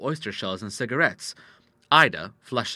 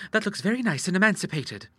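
put-on high-pitched young lady's chatter; high, airy party talk; lightly carefree daughter; 9 semitones higher than usual. A narrator's reading. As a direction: play a daughter showing off her emancipation. Voices Ida chatting at the party.

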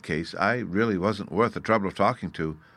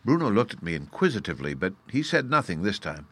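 ordinary delivery

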